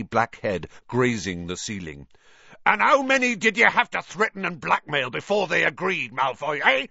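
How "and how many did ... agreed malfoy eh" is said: high, loud, furious accusation; roaring. A narrator's reading. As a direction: roar the challenge across the room. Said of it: "he roared".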